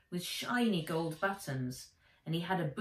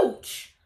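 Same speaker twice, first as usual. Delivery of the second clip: sharp high yelp of pain; 7 semitones higher, 6 dB louder than usual. Acted. Nat burned on the arm, sharp yelp.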